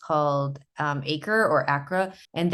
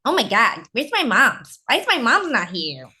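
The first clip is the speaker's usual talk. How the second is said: high, whiny voice for the dog; 6 semitones higher, 5 dB louder than usual. Acted. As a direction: voice the dog fretting for mom. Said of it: "So Georgia's voice is".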